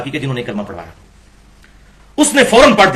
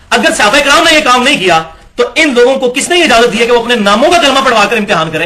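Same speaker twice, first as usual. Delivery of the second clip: laughing indignation; 6 semitones higher, 4 dB louder than usual. Real, not acted. Indignant rhetorical question to audience.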